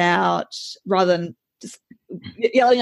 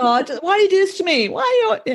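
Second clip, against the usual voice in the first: mock whiny parent; 11 semitones higher, faster than usual. Parent complaining to child.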